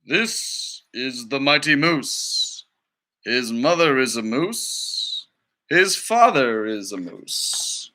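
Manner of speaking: slow, grand documentary voice; nature documentary narrator. Acted. Teacher reading to students as the documentary narrator, slow and grand. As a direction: narrate the mighty moose like a wildlife film.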